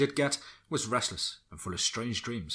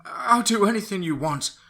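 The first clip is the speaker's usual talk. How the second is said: high, loud pleading cry; desperate scream; 12 semitones higher, 8 dB louder than usual. Acted. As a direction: beg through the pain. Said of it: "she screamed in desperation".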